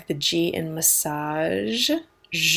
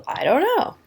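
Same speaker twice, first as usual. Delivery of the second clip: rising "I don't know"; higher unsure "I don't know"; unsure shrug; 11 semitones higher than usual. Shown as a demonstration. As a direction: shrug it off, clueless. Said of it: "like, uh".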